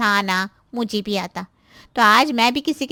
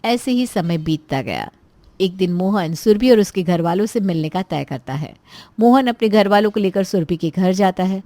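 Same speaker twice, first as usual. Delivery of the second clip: lower, steady storyteller voice; story narration; 5 semitones lower, 4 dB louder than usual. A narrator's reading. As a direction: narrate the scene calmly from outside. Narrator links the scenes.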